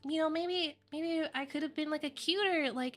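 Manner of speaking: put-on high-pitched cutesy anime girl. Shown as a demonstration. Streamer showing the cute voice she wished she had, high and cutesy. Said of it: "I wish that I spoke like this".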